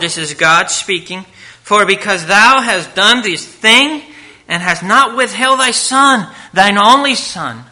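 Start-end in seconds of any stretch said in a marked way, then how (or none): 1.6-7.6 scripture reading. Acted. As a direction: read it out like the voice of God. Reading God's words aloud.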